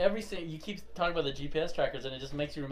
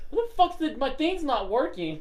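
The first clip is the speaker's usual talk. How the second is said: confused wife; 10 semitones higher than usual. Retold or imitated. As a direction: whine it like a puzzled wife. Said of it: "and she's like".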